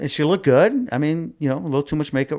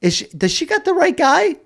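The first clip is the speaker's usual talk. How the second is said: much higher, incredulous squeak; can't believe she means you; baffled disbelief. Retold or imitated. Own baffled thought repeated.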